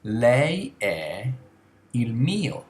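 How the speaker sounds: slow, careful Italian phrase; slow example. Shown as a demonstration. Teacher modelling the formal Italian sentence slowly. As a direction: say "il mio boss" slowly and clearly. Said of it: "Notice the difference".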